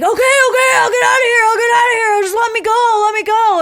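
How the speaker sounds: high, loud, whiny pleading; begging crackhead. Retold or imitated. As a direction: beg to be let go in a high wail. Crackhead begs after beating.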